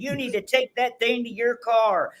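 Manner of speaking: high mock-Karen scolding; put-on video complainer. Husband pretends to scold his wife about their dog, high mock-Karen voice. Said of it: "they can see my finger saying".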